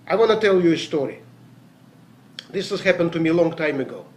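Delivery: ordinary delivery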